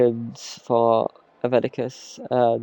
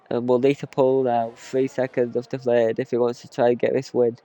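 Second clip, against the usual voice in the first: usual speaking voice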